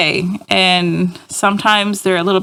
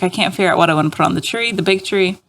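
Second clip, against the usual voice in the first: quoting herself; faster than usual. Retold or imitated. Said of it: "I was like".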